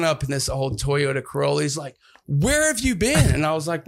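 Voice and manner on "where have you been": high, retold demanding question; mock-exasperated yell, pitched way up; exasperated brother. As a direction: imitate a brother at his wits' end. Brother demanding where he was.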